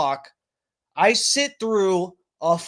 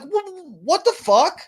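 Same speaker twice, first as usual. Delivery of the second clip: stunned disbelief; 10 semitones higher, slower than usual. Retold or imitated. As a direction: voice jumps much higher on a startled "wh-". Show ends while he's on hold.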